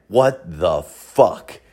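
slow, low, spaced-out words; mouthing back. Retold or imitated. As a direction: imitate mouthing it back very slowly. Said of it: "mouth back".

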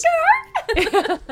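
high, squeaked American-accented "Scarf?"; exaggerated American accent collapsing into laughter; mock American accent. Shown as a demonstration. Joking with co-hosts about accents, squeaky mock American accent.

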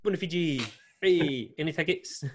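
hotel worker calling out to the Fiji team, eager. Retold or imitated. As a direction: hail the players like an overkeen fan. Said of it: "I was opened the door like".

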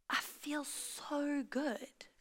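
soft whisper of contentment. Retold or imitated. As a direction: breathe it out quietly, slowly. Son's quiet happy remark.

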